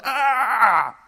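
loud high exasperated yell; frustrated scream. Acted. Martha storming into the room with a loud frustrated scream. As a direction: let out a fed-up cry. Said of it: "she's like".

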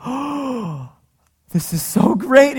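put-on high, slow, wide-eyed little girl voice; daughter's amazed gasp. Dad imitates his daughter seeing the big treat, high and amazed. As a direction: play the awestruck six-year-old. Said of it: "her eyes just got huge".

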